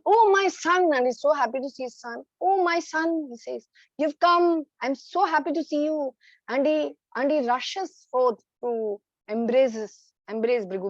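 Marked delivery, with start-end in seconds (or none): 0.0-0.9 joyful father. Acted. Father greets son joyfully. 2.4-6.1 joyful father. Acted. Father greets son happily.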